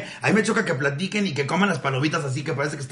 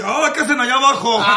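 high, laughing lofty call; mock VIP snob; 7 semitones higher than usual. Comedian playing the VIP viewer calling to those below, mock snobbish. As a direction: call down to the commoners, pitch raised. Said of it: "el arriba así".

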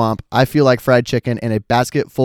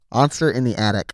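talking with a mouthguard in; words blurred by a mouthguard. Real, not acted. Putting the thing back in.

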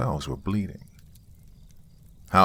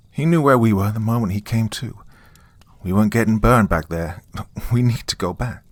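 urgent doctor voice; 4 semitones higher, 4 dB louder than usual. A narrator's reading. Doctor character voice.

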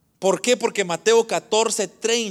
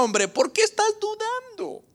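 high, incredulous question without force; Cristo; 13 semitones higher, 4 dB quieter than usual. Retold or imitated. Christ questions the doubting disciple, high-pitched. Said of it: "Lo que le estaba diciendo Cristo era".

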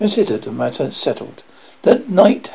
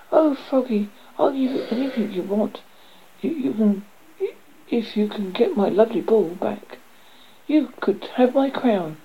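put-on high, girlish princess voice; pleading princess; 8 semitones higher than usual. A narrator's reading. Princess begs frog to fetch her ball, high pleading.

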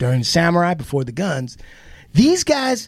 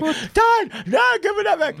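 much higher frantic plea; pleading daughter; 13 semitones higher than usual. Retold or imitated. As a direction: squeak out the plea while chasing. Daughter chasing dad again.

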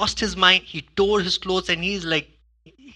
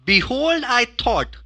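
louder, higher indignant quote; indignant Naaman; 6 semitones higher, 6 dB louder, slower than usual. Acted. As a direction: play Naaman's wounded pride loud. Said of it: "this is Naaman saying".

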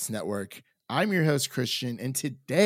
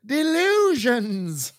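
mock Southern word; 12 semitones higher, 7 dB louder, much slower than usual. Mock accent word, laughing.

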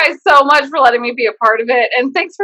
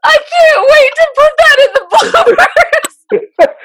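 laughing while talking; 13 semitones higher, 4 dB louder than usual. Real, not acted. Laughing through words.

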